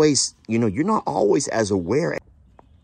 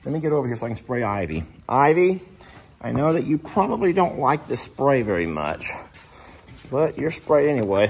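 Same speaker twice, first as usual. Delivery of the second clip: normal talking voice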